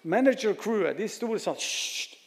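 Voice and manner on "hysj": soft, whispered mock shush; acted shushing. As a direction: imitate a crew hushing someone. Acts crew hushing the blind man.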